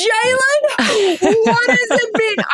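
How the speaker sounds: gasping, squealed mock interviewer gush; high-pitched overexcited. Reporter imitating her overexcited question to Jalen, high-pitched squeal. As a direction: squeak it way up, hammed-up and breathless with excitement. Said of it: "you don't want the high-pitched voice".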